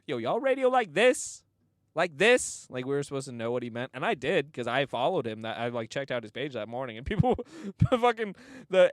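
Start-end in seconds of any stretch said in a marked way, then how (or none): 0.1-2.7 high, loud imitated question; mock challenge. Mimics radio guest's challenge.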